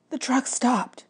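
whisper-urgent Grover warning; a hint of a jittery goat boy. A narrator's reading. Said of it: "he said".

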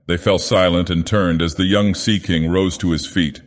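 ordinary delivery

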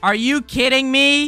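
loud, high, slow shout; exasperated yell. Acted. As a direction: shout in total disbelief at your friend. Friend has fallen yet again.

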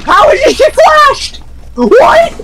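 squeaky disbelieving high-pitched yell; shocked high shout. Real, not acted. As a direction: shriek it in total disbelief. Teammate stunned by flashed enemy.

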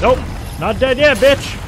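high, loud triumphant taunt; excited taunt. Real, not acted. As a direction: shout it with defiant glee. Survives with low health.

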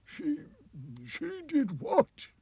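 high, aghast sobbing king; shocked crying king. Acted. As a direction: gasp it out in disbelief.

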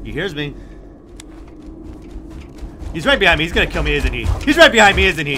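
ordinary delivery

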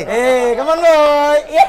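loud, high, rising cheer; excited cheering. Real, not acted. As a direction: whoop the dog on. Cheering as dog comes for food.

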